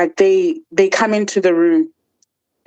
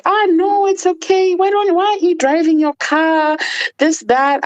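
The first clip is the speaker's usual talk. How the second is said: mock sugary high voice, much higher; sudden sweetness; 10 semitones higher than usual. Mother suddenly all sweet after the shouting, mock high sugary voice. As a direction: play the mother sweetly backpedalling, pitched way up. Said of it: "she was now like".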